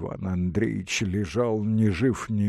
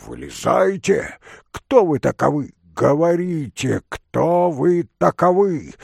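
loud, high interrogation; old man shouting; 5 semitones higher, 6 dB louder than usual. A narrator's reading. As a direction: demand answers at full voice. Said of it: "кричал старик". (старик громко требует ответа)

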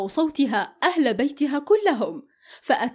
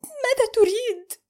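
high, strained voice on the edge of tears; near tears; 11 semitones higher than usual. A narrator's reading. Amal close to crying, asking what he wants. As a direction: hold back the tears as you ask. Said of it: "قالت وقد أوشكت على البكاء".

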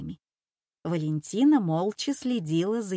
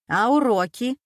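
stern, raised questioning; 4 semitones higher, 5 dB louder than usual. A narrator's reading. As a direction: check up on the child strictly. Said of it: "строго спросила Валентина".

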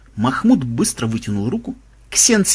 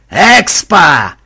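loud, greedy exclamation; bloodthirsty glee; 3 semitones higher, 9 dB louder than usual. A narrator's reading. Mahmud eyeing the bunny as experience points, bloodthirsty. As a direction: pounce on the word greedily. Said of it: "кровожадно ослабился Махмуд".

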